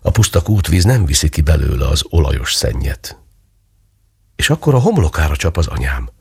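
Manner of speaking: usual speaking voice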